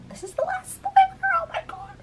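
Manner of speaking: very high, squeaky disbelief; high-pitched oh-my-God squeak; squeaky exasperation. Real, not acted. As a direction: squeal it in mock despair. Exasperated about house drama.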